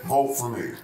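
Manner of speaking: deep, heavy campaign tagline; deep slogan voice. Acted. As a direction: drop way low for the slogan. Campaign slogan closing again.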